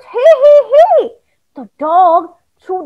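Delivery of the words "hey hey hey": calling out. Acted.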